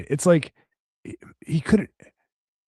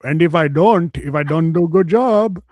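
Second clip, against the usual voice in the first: mock Indian accent, slow in places; man boasting of his fantasy in a mock Indian accent; slower than usual.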